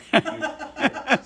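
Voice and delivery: high, loud, slow and laughing; laughing insistence. Retold or imitated. Doctor insisting to patient, high loud laugh. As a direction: push back while laughing. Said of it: "It's like".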